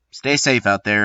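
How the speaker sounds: ordinary delivery, nothing marked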